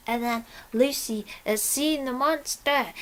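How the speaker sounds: ordinary talk, nothing marked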